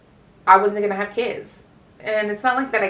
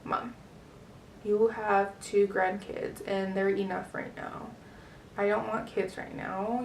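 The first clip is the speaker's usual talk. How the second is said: inner thought; 10 dB quieter than usual. Retold or imitated. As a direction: think it aloud under your voice. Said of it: "sitting in my head thinking".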